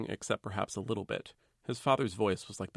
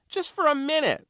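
squeaky, much higher, louder plea; insisting; 7 dB louder than usual. Acted. Postman insisting to the reluctant fellow, pitched up high. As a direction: push the plea up high and pleading. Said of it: "the postman insisted".

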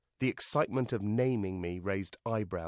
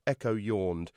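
ordinary delivery

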